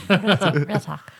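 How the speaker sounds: talking through a throat clear; words with a rough throat. Real, not acted. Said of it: "Sorry, I keep clearing my throat".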